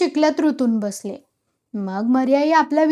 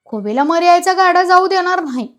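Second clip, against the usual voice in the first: higher, louder voice of refusal; shouted protest; 5 semitones higher, 5 dB louder than usual. A narrator's reading. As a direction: shout it as an angry villagers' objection. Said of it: "येऊन ओरडले". (ओरडून विरोध)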